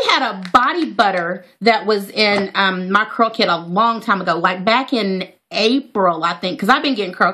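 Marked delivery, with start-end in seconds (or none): none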